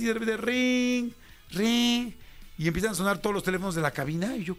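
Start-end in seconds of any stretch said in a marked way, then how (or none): 0.4-2.1 slow, high mock phone ringing; phone ring imitation. (imitando el timbre del teléfono)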